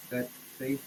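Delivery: ordinary talk, nothing marked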